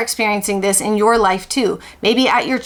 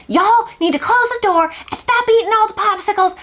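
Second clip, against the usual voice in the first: high-pitched, exasperated Southern mother; Southern mom hollering; 11 semitones higher than usual. Retold or imitated. Southern mother to her kids about the door and popsicles, hollering high. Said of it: "one minute she will be like".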